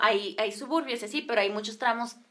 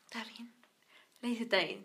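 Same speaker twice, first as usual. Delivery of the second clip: resigned acceptance; 13 dB quieter than usual. Retold or imitated. Girl accepting being left, quiet imitated resignation. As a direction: give in meekly under the breath.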